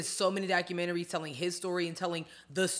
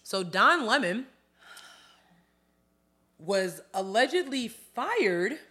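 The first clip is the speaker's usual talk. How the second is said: slow dramatic reveal; 5 semitones higher, 4 dB louder, slower than usual. Acted. Dramatic news gossip reveal.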